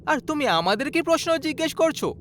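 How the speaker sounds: high-pitched, reproachful questioning; exasperated son. Acted. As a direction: throw the question back at your father. Son answers grandfather.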